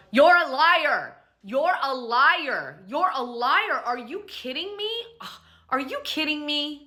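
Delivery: hammed-up accusing shout, repeated; retelling a furious guest's outburst; imitating a yell. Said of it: "And Stacy yells".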